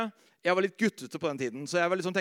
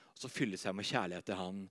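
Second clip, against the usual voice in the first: much softer and lower; tender hush; 11 semitones lower, 11 dB quieter than usual. Acted. Preacher describing gazing at Jesus, tender hush. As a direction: speak it almost to yourself.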